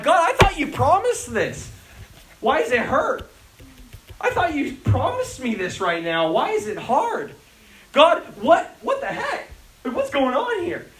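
high, pleading cry to God; anguished plea. Retold or imitated. Pastor voicing his own complaint to God, pleading. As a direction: plead with God as if hurt and confused.

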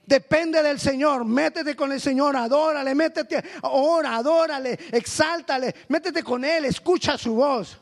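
fervent preaching. Acted. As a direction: drive each command home, preacher in full flow. Preaching at full force. (predicación fervorosa, más alta y fuerte)

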